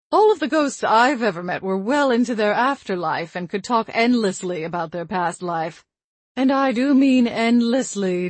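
prim grandmotherly ghost, matter-of-fact; old grandmother voice. Acted.

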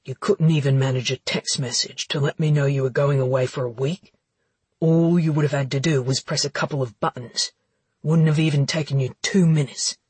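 low wounded male voice; resentful, pressing complaint; hurt, accusing low man. Acted. Connor voiced in dialogue.

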